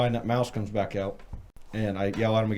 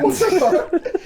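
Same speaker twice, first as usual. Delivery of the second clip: very high, loud, laughing disbelief; retold shock; 9 dB louder, slower than usual. Storyteller reacts to the caught mouse, laughing shock. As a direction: act out being stunned, voice shooting up.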